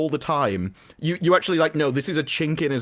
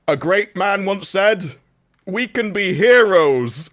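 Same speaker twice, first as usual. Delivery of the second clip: slow, grand, louder pronouncement; mock heroic speech; 5 semitones higher, 5 dB louder, slower than usual. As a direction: deliver it like a pompous hero. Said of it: "he got".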